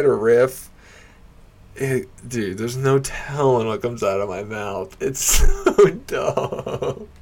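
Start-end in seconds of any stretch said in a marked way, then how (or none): 5.0-7.2 higher, drawn-out words through laughter; real laughing. Real, not acted. Comic laughing at his own riffing, giggly. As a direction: crack up while dragging the words out.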